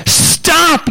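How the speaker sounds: sharp high yell of Stop!. Retold or imitated. Butcher stopping the angry little man, high sharp yell. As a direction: cry out high to halt the man. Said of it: "yells the butcher".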